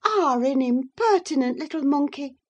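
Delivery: high, loud, astonished scolding; surprised exclamation. A narrator's reading. Missus Linton scolds her sister-in-law, surprised exclamation. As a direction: exclaim it in startled reproof. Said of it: "exclaimed Missus Linton in surprise".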